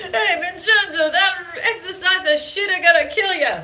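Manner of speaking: badly faked Italian accent, played for laughs; crappy Italian accent. Redoing grandfather's warning to dad, crappy Italian accent. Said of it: "my really crappy version of an Italian accent".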